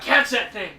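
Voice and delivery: loud urgent command. Retold or imitated. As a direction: bark it out. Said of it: "And I was like".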